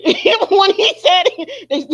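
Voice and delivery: loud, high and laughing. Real, not acted. Host retelling what he said, cracking up loudly. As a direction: burst out laughing mid-sentence.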